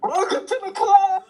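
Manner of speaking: high, loud mock wail; mock welcome. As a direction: call out a hammed-up, tearful welcome. Mock welcome to depressed friend.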